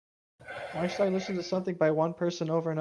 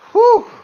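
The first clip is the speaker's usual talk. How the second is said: big loud relieved exhale; loud whew of relief; 17 dB louder than usual. Real, not acted. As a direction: let out a huge sigh of relief. Relief after no slash.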